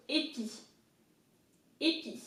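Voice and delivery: "épi" as "ay-pee"; French "épi", closed E and "ee" ending; French pronunciation. Shown as a demonstration. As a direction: say "épi" in careful French, repeated after a pause. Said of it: "then I is pronounced I".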